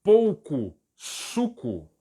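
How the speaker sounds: "pouco suco" said very slowly; slow Portuguese. Shown as a demonstration. Teacher slowly giving the Portuguese phrase first. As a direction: draw out "pouco suco" word by word. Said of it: "Se eu quiser dizer a frase".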